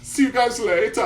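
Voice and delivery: put-on high, loud German accent. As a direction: toss it off in a cartoon German accent. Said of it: "every German voice I've ever heard".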